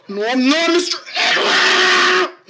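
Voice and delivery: a put-upon patient protesting his name; whiny protest. Acted. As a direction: wail the denial like a wounded man-child. Voices whiny K Rob character.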